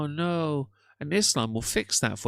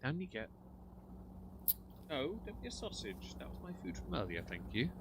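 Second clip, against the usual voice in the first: talking to a pet; 6 semitones higher, 14 dB quieter than usual. Real, not acted. Scolding pet softly.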